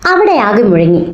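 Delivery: ordinary delivery, nothing marked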